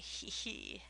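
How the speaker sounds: soft wicked little cackle; fiendish chuckle. Acted. Peg gloating over her neighbour, fiendish chuckle. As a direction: chuckle like a gleeful witch. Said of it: "Peg chuckled quite fiendishly".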